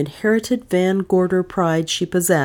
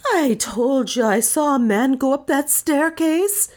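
high-pitched wail of complaint; high, frightened wailing voice; 10 semitones higher than usual. A narrator's reading. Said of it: "she wailed".

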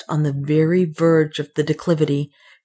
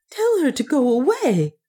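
rejecting heroine; 9 semitones higher than usual. A narrator's reading. Narrator voices Juana rejecting Soa.

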